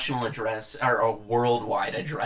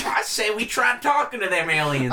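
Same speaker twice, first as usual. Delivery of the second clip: mock Republican; 6 semitones higher, slower than usual. Voicing a Republican.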